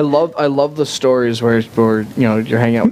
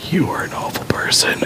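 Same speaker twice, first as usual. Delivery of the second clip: soft whispered appalled aside; whispered disgust; 4 semitones higher, 5 dB quieter than usual. Retold or imitated. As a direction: gasp then whispered you are an awful person. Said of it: "It was me going".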